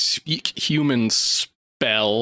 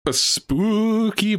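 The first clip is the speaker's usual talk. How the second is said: slow, eerie spooky emphasis on spooky; 8 semitones higher, much slower than usual. Shown as a demonstration. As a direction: drag out spooky like a ghost story. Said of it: "A scary book".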